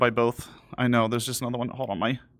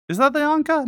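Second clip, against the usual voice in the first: surprised; 13 semitones higher than usual. Real, not acted. Sudden surprised question.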